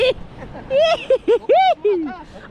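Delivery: high, squealing laughter. Real, not acted. Vlogger pleased with the vlog, high laughter.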